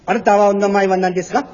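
fast, rising mock Japanese phrase. Shown as a demonstration. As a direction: rattle it off like a stage Japanese speaker. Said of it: "注意听着".

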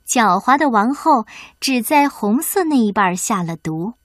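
plain reading voice

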